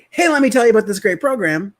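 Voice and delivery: hammed-up eager salesman; overexcited pitch. Shown as a demonstration. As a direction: burst in with too much energy. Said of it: "and you come in".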